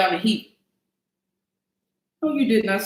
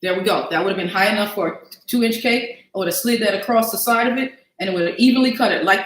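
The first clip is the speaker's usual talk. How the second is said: normal talking voice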